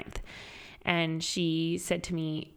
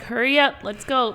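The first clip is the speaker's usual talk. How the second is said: retold louder, higher hurry-up; urging; 5 semitones higher, 7 dB louder than usual. Doctor hurrying dad to the surgery, urgent. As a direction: chivvy him along, raised voice.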